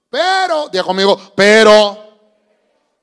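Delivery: loud, slow pulpit call; booming preacher's call-and-response; preaching at full force. Real, not acted. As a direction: drag it out to make the church repeat. Preacher calls congregation to repeat.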